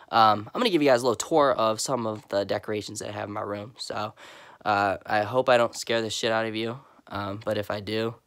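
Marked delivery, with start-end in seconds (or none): none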